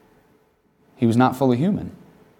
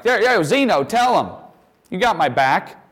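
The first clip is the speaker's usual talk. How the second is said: smug showing off; 7 semitones higher than usual. Pretend. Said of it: "being like".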